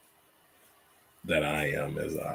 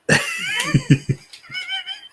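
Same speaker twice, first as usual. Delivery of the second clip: high-pitched burst of real laughter; laughing; 4 dB louder than usual. Real, not acted. Host cracks up at a viewer's comment, laughing.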